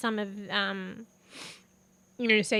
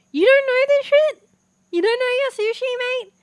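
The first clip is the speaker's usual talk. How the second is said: mock outrage; 14 semitones higher, 10 dB louder than usual. Teasing viewer about sushi.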